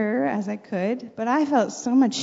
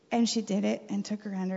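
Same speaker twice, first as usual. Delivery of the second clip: ordinary talk, nothing marked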